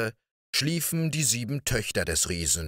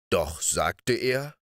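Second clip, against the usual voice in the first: ordinary delivery, nothing marked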